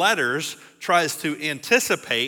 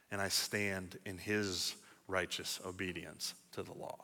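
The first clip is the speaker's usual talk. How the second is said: much softer, lower, fading out; fading prayer; 7 semitones lower, 15 dB quieter than usual. Acted. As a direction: let the prayer die away. End of voiced prayer.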